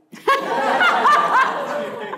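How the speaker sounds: loud burst of laughter, high and bright; big high laugh. Real, not acted. Recalling being let into law school, loud laughter. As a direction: crack up at the memory.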